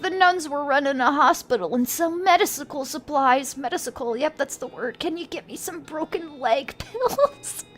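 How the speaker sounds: put-on casualty voice, pitch swinging up; injured man. Injured man asking stranger to fetch pills, put-on pleading voice. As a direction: plead lightly as a comic invalid.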